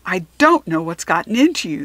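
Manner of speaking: loud scolding principal; exasperated principal yelling; yelled. Acted. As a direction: tell her off. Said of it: "the principal yelled".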